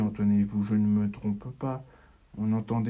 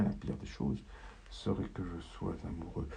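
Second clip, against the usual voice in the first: whispered; 4 semitones lower, 7 dB quieter than usual. Acted. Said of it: "je me le chantonne".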